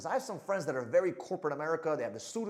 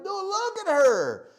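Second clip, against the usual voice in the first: much higher, louder and slow; insistent retort; 13 semitones higher, 10 dB louder, much slower than usual. Retold or imitated. Coach telling a shy dater to look at her, loud and slow. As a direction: stretch it out like scolding a shy student. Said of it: "I'm like".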